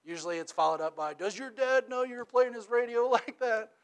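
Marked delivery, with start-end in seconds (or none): 1.1-3.7 teasing scold. Retold or imitated. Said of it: "That's how I say it".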